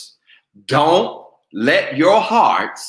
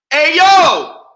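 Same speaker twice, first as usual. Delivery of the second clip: mock catcall; 11 dB louder than usual.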